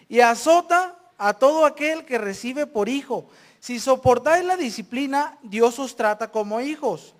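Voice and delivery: loud, emphatic Bible reading; scripture reading. Acted. Preacher reading on discipline to the congregation, loud and emphatic. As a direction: proclaim the passage, pausing for the congregation.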